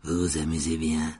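low-pitched courteous host voice; ghostly host. Acted. Ghost host greets his guests.

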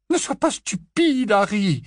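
very high, slow motherly rebuke; Mrs Weasley retorting. A narrator's reading. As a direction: scold Harry like a mother. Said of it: "répliqua missus Westley".

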